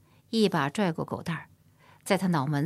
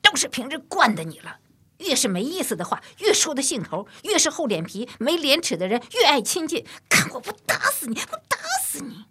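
louder, higher and higher until a shrill near-scream; furious scolding; 9 semitones higher, 4 dB louder than usual. A narrator's reading. Humiliated mother beats and screams at her son, building to shrill fury. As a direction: scold the boy harder and harder, almost in tears. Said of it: "骂道".

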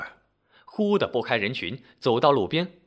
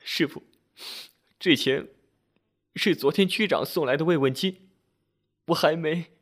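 halting, higher-pitched sniffling voice; tearful; 4 semitones higher than usual. A narrator's reading. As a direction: explain haltingly through understated sobs. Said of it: "抽泣着说".

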